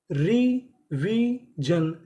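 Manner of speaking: very slow "revision", three long separate syllables. Shown as a demonstration. Teacher reads the next word to learners, slowly syllable by syllable. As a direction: draw out each syllable of "revision" with a gap.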